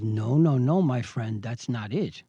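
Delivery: low, measured correction; low, knowing rebuttal; firm refusal. Retold or imitated. Firm rebuttal to gloating adversary.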